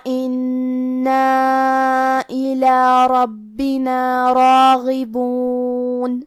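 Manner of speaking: very slow, level-toned tajweed chant; Quran recitation. Shown as a demonstration. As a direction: recite with long, measured elongations. (ٹھہر ٹھہر کر تجوید سے تلاوت)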